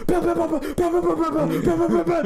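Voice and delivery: high, loud pow pow; gunshot noises. Retold or imitated. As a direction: mimic rapid gunfire. Mimicking gunfire.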